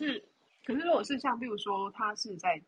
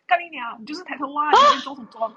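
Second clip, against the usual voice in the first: angry; 8 semitones higher, 12 dB louder, faster than usual. Retold or imitated. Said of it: "他就说".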